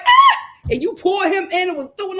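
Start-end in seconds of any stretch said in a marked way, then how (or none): 0.1-0.4 high, loud mock yelp of shock; mock startled yelp. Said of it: "Guy was like".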